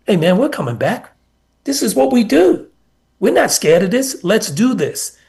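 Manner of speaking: rally the guys, climbing up in pitch; fired-up rally. Retold or imitated. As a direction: player hyping teammates up at halftime, excited. Player rallying team at halftime.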